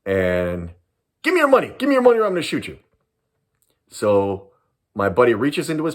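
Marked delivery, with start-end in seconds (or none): 1.2-2.8 much higher, fast, pushy threat; robber's threat. Retold or imitated. Gunman threatening to shoot, fast and heated. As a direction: rush the threat like a nervous gunman.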